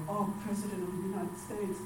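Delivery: hushed, timid awe; small, softened voice of a daunted child; mock scared. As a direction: play it quietly intimidated. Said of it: "was like, really scared".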